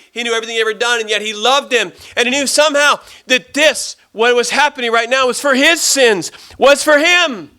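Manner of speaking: high, urgent, building proclamation; rising full-force sermon climax; impassioned preaching. Real, not acted. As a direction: build to a loud cry on the last words.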